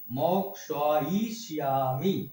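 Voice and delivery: মক্সাইশিয়ামি slow and careful, every letter sounded; drawn out মক্সাইশিয়ামি; Sanskrit pronunciation. Shown as a demonstration. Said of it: "এটা হচ্ছে সংস্কৃত উচ্চারণ".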